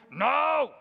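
gruff burly shout; burly fisherman shout. Retold or imitated. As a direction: boom it out like a big Galilean fisherman. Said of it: "big man, burly voice".

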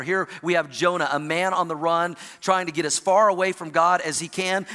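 ordinary talk, nothing marked

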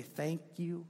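soft, sincere thank you, fading; simple thanks. Retold or imitated. Preacher shows the humble answer to God, softly. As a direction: say it quietly and simply. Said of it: "We simply say".

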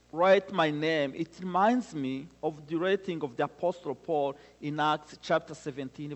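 normal talking voice

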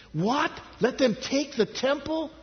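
high, astonished questioning; incredulous objection. Acted. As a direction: protest in rising disbelief. People object, escalating disbelief.

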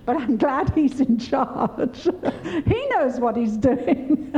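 laughing while speaking; laughing through words. Real, not acted. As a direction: chuckle through it, amused at herself. Laughs through her own words.

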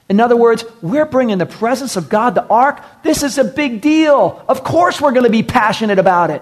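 rally the room; fired-up preaching. Real, not acted. Preacher urging church to celebrate God, fired up and loud.

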